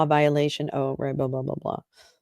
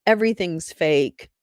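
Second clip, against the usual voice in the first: ordinary delivery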